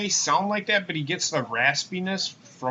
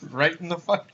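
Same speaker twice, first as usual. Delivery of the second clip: ordinary delivery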